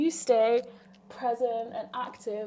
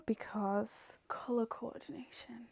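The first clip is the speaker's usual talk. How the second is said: whispering; 10 dB quieter than usual. Real, not acted. Joking aside.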